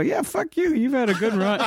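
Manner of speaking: high-pitched comic comeback; playfully indignant, starting very high then easing; mock defiant retort. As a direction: throw it back, voice jumping up. Retold retort to indignant friend.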